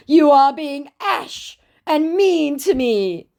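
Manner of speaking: high, slow, wounded whine; whiny scammer voice. Retold or imitated. Scammer whining to the narrator, mock high hurt voice. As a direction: play the hurt victim, drawn out. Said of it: "He said".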